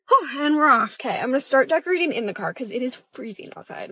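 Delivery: usual speaking voice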